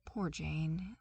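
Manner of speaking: usual speaking voice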